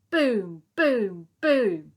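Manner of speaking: loud, booming door knocks; loud knocking sounds. Acted. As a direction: bang it out like knocking. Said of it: "a loud knocking".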